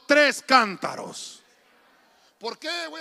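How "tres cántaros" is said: tres cántaros shouted slow, tres stressed; call-and-response. Shown as a demonstration. Preacher has the church repeat after him, loud. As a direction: lead them, loud and drawn out. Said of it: "Diga conmigo".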